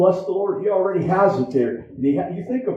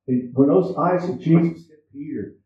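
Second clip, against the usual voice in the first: ordinary talk, nothing marked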